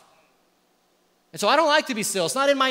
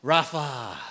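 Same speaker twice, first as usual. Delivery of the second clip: exhaled word; 7 semitones lower than usual. Shown as a demonstration. Pastor has the congregation exhale "Rapha" again. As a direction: let "Rapha" ride the out-breath. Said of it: "Breathe in".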